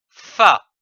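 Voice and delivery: "fa" said loudly and clearly as a model; loud syllable demo. Shown as a demonstration. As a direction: call out the syllable "fa" for the class. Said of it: "F-A".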